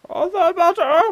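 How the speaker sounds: high mock repeat. Streamer repeats game line mockingly.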